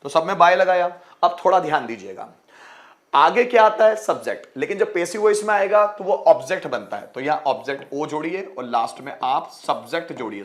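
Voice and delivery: ordinary delivery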